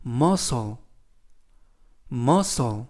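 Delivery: muscle as MUSS-ul, silent C; model pronunciation. Shown as a demonstration. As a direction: say muscle low and clear for review. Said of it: "muscle이라고 발음하시면 되겠습니다".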